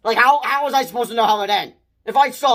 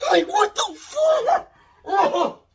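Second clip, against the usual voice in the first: shocked outburst; 12 semitones higher, much slower than usual. Real, not acted. Real shock at the gory scene.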